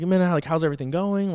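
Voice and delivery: imitating an upbeat check-in with a patient; bubbly. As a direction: put on a chirpy carer persona. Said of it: "bubbly, you know, that type of thing".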